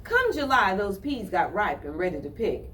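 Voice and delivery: ordinary delivery, nothing marked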